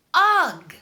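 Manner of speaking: a loud groan of disgust; disgusted ugh. Real, not acted. Boy hating his real name, loud disgusted groan. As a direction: spit out a loud "ugh" of revulsion. Hating being called Maxwell.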